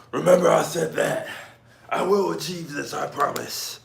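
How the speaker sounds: high, strained grand promise; mock vow. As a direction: declare it like a heroic vow. Mock solemn vow to viewer.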